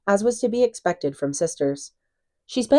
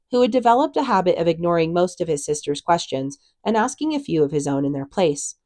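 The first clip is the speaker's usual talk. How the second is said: ordinary delivery, nothing marked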